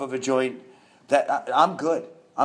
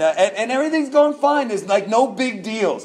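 high, laughing through the words; real laughter breaking into the line; 7 semitones higher than usual. Real, not acted. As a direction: giggle it out, pitched up. Laughing about going off the rails.

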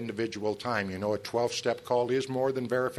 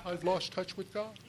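higher, softer, slow whine; mock complaining newcomer; 7 semitones higher, 6 dB quieter than usual. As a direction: sigh it out like a self-pitying newcomer.

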